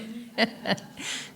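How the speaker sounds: soft amused laughter. Real, not acted. Speaker telling of the runaway nephew, soft laugh.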